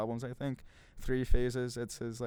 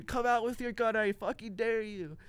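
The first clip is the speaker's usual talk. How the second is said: retold scream; 10 semitones higher than usual. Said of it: "like, screaming".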